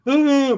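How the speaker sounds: high, loud mock scared noise; short burst of exaggerated fright; mock frightened cry. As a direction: yelp as if terrified, hammed up. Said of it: "most scared that I've been in".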